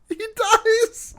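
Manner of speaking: squeaky high words through laughter; helpless high-pitched laughing reply; cracking up. Real, not acted. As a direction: barely get it out for laughing. Cracking up at the line.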